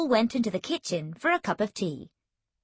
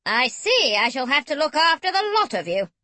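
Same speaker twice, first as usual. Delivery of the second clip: grim; 5 semitones higher, 4 dB louder than usual. A narrator's reading. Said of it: "Granny One said grimly".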